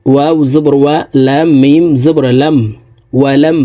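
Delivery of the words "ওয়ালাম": usual speaking voice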